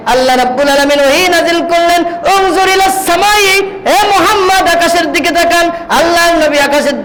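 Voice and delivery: high-pitched sermon delivery; impassioned preaching. Acted. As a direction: preach it high and urgent, lines held up at the top.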